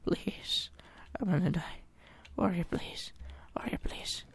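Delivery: faint pleading whisper; mock dying whisper to the warrior; whispered begging. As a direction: beg the warrior under your breath. Begging warrior companion for help.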